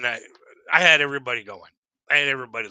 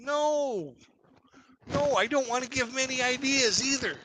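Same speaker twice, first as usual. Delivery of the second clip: mock alarm; 10 semitones higher than usual. High refusal to the manager.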